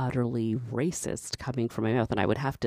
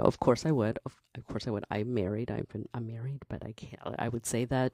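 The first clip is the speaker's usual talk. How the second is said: usual speaking voice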